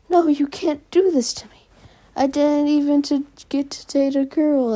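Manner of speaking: high, whiny, half-whispered wail; mock crying plea. Mineta begging the teacher not to expel him, whiny wail. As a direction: whine it like a kid about to cry. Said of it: "Mineta starts crying".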